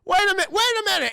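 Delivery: fast, high, loud interruption; hammed-up panicky protest; mock alarm. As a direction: frantically call a halt. Startled hero protesting.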